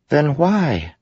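high, pressing little voice; spren voice. A narrator's reading. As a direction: push for the answer, high and light. Syl presses.

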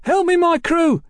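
put-on very high, desperate boyish yell; screech. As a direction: screech out to summon the gang. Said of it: "the boy screeched".

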